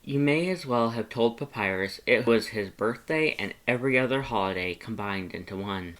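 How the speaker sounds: usual speaking voice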